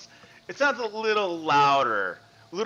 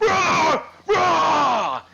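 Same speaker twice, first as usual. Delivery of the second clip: loud, high wordless roar; furious shouted "rah"; angry roar; 9 dB louder than usual. Acted. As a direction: bellow like a raging thing behind a door. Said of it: "more banging, more shouting".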